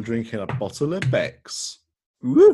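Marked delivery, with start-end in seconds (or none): 2.2-2.5 loud, high whoop; excited cheer. Real, not acted. Cheers at his own drink.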